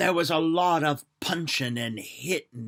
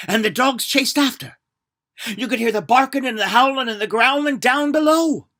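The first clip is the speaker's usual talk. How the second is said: louder and higher, driving forward; excited narration; 8 semitones higher, 13 dB louder than usual. A narrator's reading. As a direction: tell it with rising excitement. Dogs chase, excited telling.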